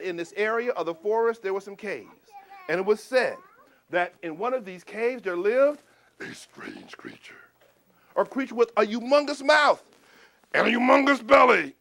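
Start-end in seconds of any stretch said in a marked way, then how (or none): 5.2-7.3 slow eerie whisper; spooky whisper. Acted. Storyteller to children, whispering about the creature. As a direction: lower your voice to scare them.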